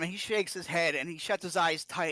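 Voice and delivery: usual speaking voice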